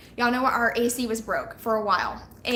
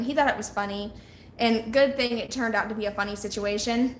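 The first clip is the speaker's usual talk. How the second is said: ordinary talk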